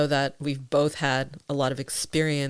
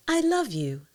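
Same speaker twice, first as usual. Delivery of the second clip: tender I love you; 8 semitones higher than usual. Shown as a demonstration. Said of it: "when said with kindness and tenderness".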